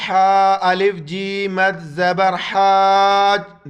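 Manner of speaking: loud, drawn-out teacher spelling; spelling drill. Shown as a demonstration. Teacher to students, loud spelling drill. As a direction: call each letter out to the class. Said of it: "counting دیکھ لیں آپ".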